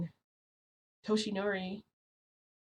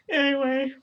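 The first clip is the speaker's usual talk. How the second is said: awkward moving on; 9 semitones higher, 6 dB louder than usual. Real, not acted. Moves on from awkward laugh.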